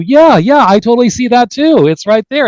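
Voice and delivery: high, eager mock agreement; a hammed-up excited yeah yeah. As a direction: play the eager listener who sees it too. Quoting an eager agreeing listener.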